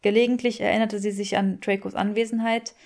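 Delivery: plain reading voice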